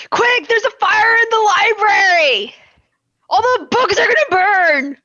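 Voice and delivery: high, drawn-out cry of alarm; staged alarm yell. Acted. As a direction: raise a theatrical fire alarm. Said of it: "into the room and yell".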